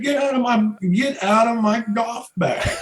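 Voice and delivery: high mock yell of outrage; retold yell. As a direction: shout it high and indignant. Yelling at Spurrier over putter.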